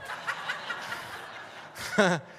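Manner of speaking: breathless airy laugh way up high; high unvoiced laugh. Real, not acted. Preacher cracking up at his own bit, a high breathless laugh. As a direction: laugh helplessly at the joke, no voice. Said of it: "I pulled my hamstring".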